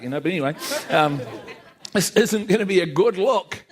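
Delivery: normal talking voice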